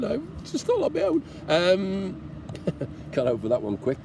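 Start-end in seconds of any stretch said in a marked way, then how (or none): 0.0-1.2 high, hammed-up wail of loneliness; mock crying. Lone biker teasing viewers about travelling alone, mock sob. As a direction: fake a tearful whimper. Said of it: "I'm gonna start crying again".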